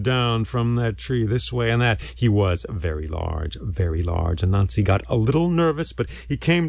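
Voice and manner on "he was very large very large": low, slow and drawn out; awed emphasis. A narrator's reading. Storyteller describing the huge python, weighty and low. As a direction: drop deep to show great size. Narrator stresses the python's size.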